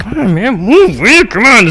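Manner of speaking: mock frustration, kept under the breath; frustrated muttering. Student stuck behind the lecturer, frustrated. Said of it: "he's getting frustrated".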